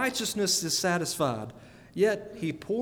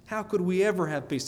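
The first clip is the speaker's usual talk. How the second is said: brisk run through "How could we ever have peace?"; quick repeated question; faster than usual. Real, not acted. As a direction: toss the question out fast.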